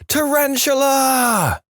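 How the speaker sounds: high, drawn-out acted scream; sudden high-pitched shriek. A narrator's reading. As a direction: scream it in sudden panic, stretching the word. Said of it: "throwing back the covers and screaming".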